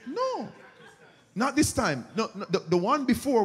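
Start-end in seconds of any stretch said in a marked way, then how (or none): 0.1-2.1 laughing no. Acted. Mock refusal, laughing.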